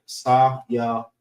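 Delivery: Sa-ya-wan slowly, each syllable distinct. Shown as a demonstration. Teacher showing how syllables make a word, Sa-ya-wan slowly separated. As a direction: draw out Sa-ya-wan in three beats. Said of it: "put all three syllables together".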